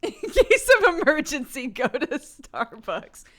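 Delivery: loud, slow mock slogan. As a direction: deliver it like a jingle tagline.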